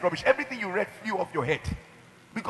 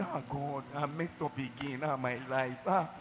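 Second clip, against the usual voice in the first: softer, lower moaning complaint; self-pitying lament; 4 semitones lower, 8 dB quieter than usual. Retold or imitated. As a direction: moan like a self-pitying victim. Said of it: "people will ... go and say".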